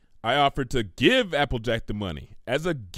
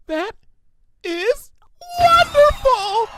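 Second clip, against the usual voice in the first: much higher, much louder, slow stretched squeal; ecstatic shriek; 9 dB louder, slower than usual. Acted. Pinkie hears Twilight is rich, ecstatic squeal. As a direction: burst with joy on wonderful.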